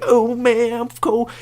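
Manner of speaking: high-pitched mock-girlish complaint; put-on high voice of a chilly date; shivering girl impression. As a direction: imitate her whining about the cold. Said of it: "She gonna be shivering like".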